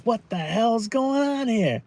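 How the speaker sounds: high, slow mock bewilderment; a stretched-out, hammed-up what-the-hell; baffled disbelief. Imitating a confused fan, high and slow mock disbelief. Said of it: "and you're like".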